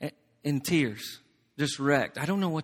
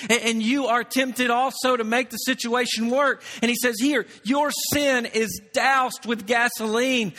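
higher and louder, pressing each point; impassioned preaching; 8 semitones higher, 3 dB louder than usual. Acted. Preacher warning the church about temptation, impassioned. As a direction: build the fire of a sermon.

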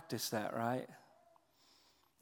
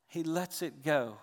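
ordinary talk, nothing marked

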